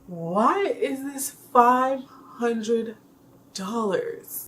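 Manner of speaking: slow, drawn-out, incredulous Why is this five hundred dollars; dragged-out exasperated repeat; slow disbelief. Retold or imitated. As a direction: stretch it out in mock outrage.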